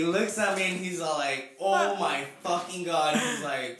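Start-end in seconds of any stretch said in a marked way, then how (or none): 1.6-3.2 drawn-out, louder mock amazement; imitating a floored reaction; retold astonishment. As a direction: stretch it out like a stunned brother. Retelling brother's shock at whole cookie eaten.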